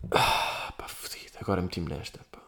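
unvoiced whispered aside. Real, not acted. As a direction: mutter it to yourself under breath. Caught out, muttering to self.

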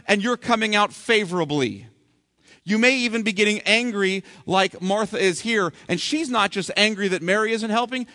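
ordinary delivery

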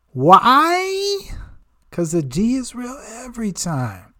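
high, excited brag; hype shout. Pretend. Hyping his own name.

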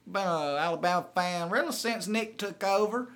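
a proud Bama fan introducing himself; Hank the caller. Pretend. Hank calling Paul to brag about Alabama, Southern twang. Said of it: "This is Hank out of Bellevue, Alabama".

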